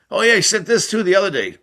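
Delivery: high, lively quoted voice; bright, lifted teammate voice. Retold or imitated. Teammate quoted in locker room.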